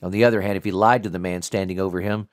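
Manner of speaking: ordinary delivery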